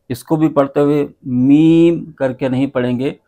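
ordinary delivery

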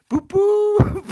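very high, very loud train toot; piercing high hooting; screamed boop. Pretend. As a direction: shriek it like a train whistle. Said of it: "I'm screaming boop".

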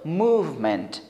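मूवमेंट with a clear v sound, moov-ment; मूवमेंट said with a distinct v; correct pronunciation. Shown as a demonstration. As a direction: stress the v in मूवमेंट. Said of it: "इसमें वी ई sound है".

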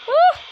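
high, loud yelp; single frightened shriek; scream of fright. Real, not acted. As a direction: scream as it reaches you. Jump scare.

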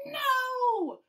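high loud cry of dismay; dismayed shout. Acted. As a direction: cry out in horror. Plastic wrap on toilet.

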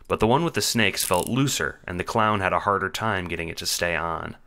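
ordinary delivery

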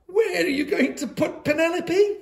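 high, worried, pleading question; anxious little girl. A narrator's reading. Miranda asking where her snake goes, high and worried. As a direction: ask it small and afraid.

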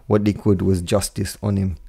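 ordinary delivery

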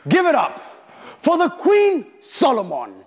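building to a loud, high hype call; hype announcer. Acted. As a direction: announce her like a stage MC. Host announces next contestant.

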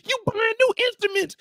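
high, loud indignant yell; mock wife yelling high; shouting wife. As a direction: fire back at the husband. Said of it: "She said".

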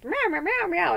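high mock meows; imitating a whiny cat; cat meowing. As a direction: squeak out a cat's complaining meows. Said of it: "she was like".

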